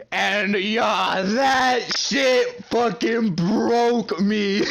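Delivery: high, slow, laughing through the words; real laughter breaking up the line. Real, not acted. Teller laughing about the moment that broke him.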